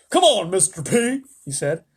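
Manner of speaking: high, loud pleading general's voice; army chief egging on the president; eager army chief. A narrator's reading. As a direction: wheedle for explosions. Army chief begging for explosions.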